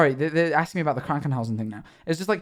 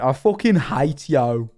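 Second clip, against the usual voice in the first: slow, drawn-out Brummie imitation; Brummie accent; slower than usual. Retelling the arguing Brummie's line, Brummie accent. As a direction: stretch the vowels in a Birmingham accent. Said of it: "the Brummies".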